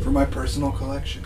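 a touch of a collector's voice; a hint of a smug collector; NPC voice. GM voicing NPC reply.

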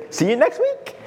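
high, light goodbye; breezy acted sign-off; mock casual send-off. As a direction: toss off a playful farewell. Mock church's send-off after lashing.

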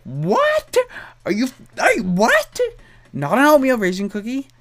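high, loud, incredulous "what?"; shocked disbelief. Retold or imitated. Cookie seller stunned she isn't ordering oatmeal raisin, high loud disbelief. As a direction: squeal it like you can't believe your ears.

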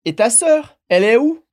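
"Et ta sœur, elle est où" pushed upward at "sœur" and again at "où"; rising question intonation. Shown as a demonstration. French teacher leading learners in repetition, rising intonation. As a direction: make "où" climb clearly at the end. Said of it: "Allez, dernière fois".

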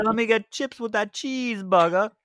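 high mock British accent; British accent with no R's. Joking along in the British bit, high British accent. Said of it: "No R's".

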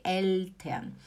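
"Eltern" with a true, clear L. Shown as a demonstration. Teacher confirming the correct word, clear L. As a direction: repeat "Eltern" with tongue up on the L. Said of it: "é El".

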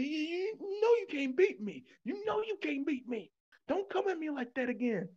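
high-pitched, hammed-up warning; mock indignant challenge. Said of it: "He said".

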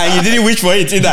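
high-pitched, laughing punchline; laughing through the words; laughing tease. Real, not acted. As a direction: tease them, breaking into laughter. Teasing Wish pun, then cracking up.

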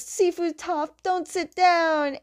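high, slow, desperate call to the teacher; urgent shout. Retold or imitated. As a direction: stretch it out, pleading her to stay up. Student yells to teacher mid-fight.

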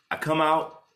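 ordinary talk

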